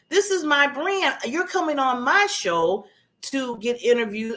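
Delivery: firm, self-assured declaration; confident. Acted. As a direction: stand your ground, slowing on key words. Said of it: "now I say I'm confident".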